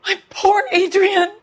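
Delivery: high, loud cry of shock and grief; anguished outburst; distraught cry. Acted. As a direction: break out in alarm for her husband. Wife hears of blood at scene.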